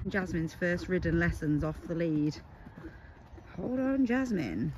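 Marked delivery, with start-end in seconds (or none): none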